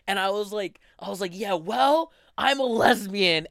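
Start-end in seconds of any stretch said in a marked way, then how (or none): 1.3-3.5 loud retold shout at dad, slow on the last words; yelling back. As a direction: fire it back at him as a loud retort. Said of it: "I started to yell back at him".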